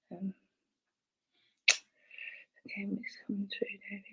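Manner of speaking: barely audible quick whisper; hushed thinking aloud; whispering to oneself. Real, not acted. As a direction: murmur it privately, very softly and fast. Murmuring to self while tuning in.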